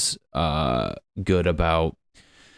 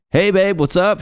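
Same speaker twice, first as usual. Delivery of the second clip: much higher, louder, chirpy greeting; clueless boyfriend; 13 semitones higher, 6 dB louder than usual. Retold or imitated. Boyfriend answering the phone on stage, cheery mock greeting. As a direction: play the oblivious cheerful boyfriend. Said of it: "the guy's just like".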